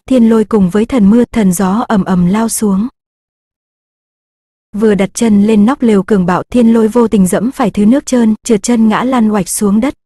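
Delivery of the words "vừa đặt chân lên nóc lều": ordinary delivery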